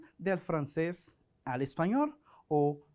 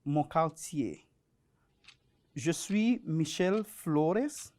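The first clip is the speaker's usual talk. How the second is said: reading a text aloud. Acted. Reads French text aloud.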